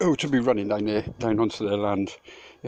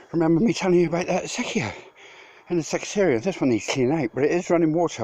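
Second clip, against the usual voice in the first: out of breath; 7 semitones higher than usual. Real, not acted. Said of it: "So out of breath".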